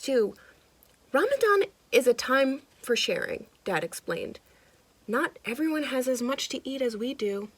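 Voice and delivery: lively storybook narration; animated read-aloud for kids; reading to children. A narrator's reading. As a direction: read it like a picture book to a child. Reading picture book aloud.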